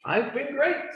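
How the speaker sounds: high, pleased reply; a light happy Moon Jae-in voice; happy great. Acted. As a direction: sound delighted. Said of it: "Moon Jae-in's been great".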